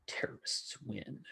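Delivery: slow, soft joking whisper. Real, not acted. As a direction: breathe it as a mock aside. Joking aside after a laugh.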